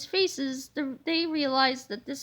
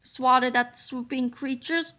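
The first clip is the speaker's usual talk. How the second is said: plain reading voice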